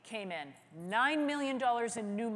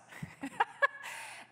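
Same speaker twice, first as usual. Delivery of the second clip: a quick unvoiced high giggle of disbelief; breathless high laugh. The speaker laughing at Televisa's reaction, high and breathy.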